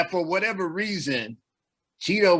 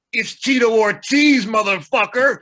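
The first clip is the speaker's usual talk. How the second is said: loud, bragging big reveal; higher, punchy self-announcing swagger; Tito Ortiz; 4 semitones higher, 5 dB louder than usual. Retold or imitated. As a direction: boom it out like a fighter unmasking himself. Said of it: "in pure Tito Ortiz fashion".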